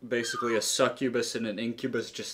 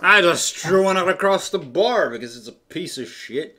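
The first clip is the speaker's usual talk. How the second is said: higher, louder orc outburst; 6 semitones higher, 8 dB louder than usual. Acted. Orc griping to a patron about fae, loud and raised. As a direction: rant loudly as a rowdy orc. Game master plays the rowdy orc.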